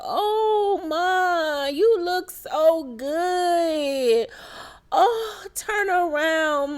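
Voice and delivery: high, slow, weepy voice with a crying break; mock tearful Sierra. Sierra gushing to her mom, hammed-up tearful and high. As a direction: gush through fake tears, drawn out.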